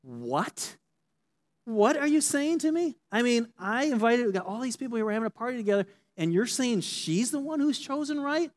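can't believe what she's hearing; indignant disbelief. Retold or imitated. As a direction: Martha protesting to Jesus in disbelief. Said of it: "she's thinking".

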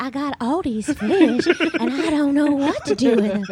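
put-on high, laughing old-Hollywood starlet voice. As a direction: play a glamorous twenties film star, laughing. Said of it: "like a nineteen twenties Hollywood starlet".

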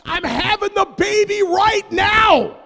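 high, slow loud yell; panicked wife yelling. Retold or imitated. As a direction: scream the news to wake him. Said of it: "She's like".

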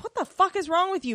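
high, quick retold indignation; mock outrage. Retold outraged reaction to a killing.

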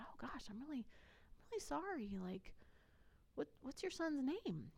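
soft, lifted voice of concern; gentle sympathy. Retold or imitated. Asking a worried mother her son's name, gently sympathetic. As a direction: retell a hushed, caring apology.

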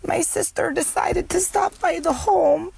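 high, slow mock-elderly voice; ninety-five-year-old. Shown as a demonstration. Host mocking how they just said the home, high elderly voice. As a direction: exaggerate it into a drawn-out, high old-person voice. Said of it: "sounded like a fucking ninety-five-year-old".

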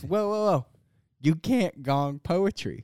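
drawn-out, emphatic host intervention; imitating stage host. Host Mike stops the gong over poetry, imitated slow proclamation. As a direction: halt the crowd, then lay down the rule slowly. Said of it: "Mike comes on stage".

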